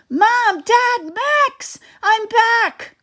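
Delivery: very high, loud, joyful calls; happy calling out. A narrator's reading. Fred calls to his family at the tent, high and excited. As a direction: announce your return to the tent.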